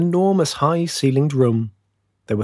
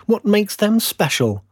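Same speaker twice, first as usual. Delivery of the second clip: high, resentful little fir; pitched-up envious complaint; bitter; 10 semitones higher than usual. A narrator's reading. Little fir envying the chosen trees, high and bitter. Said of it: "it said bitterly".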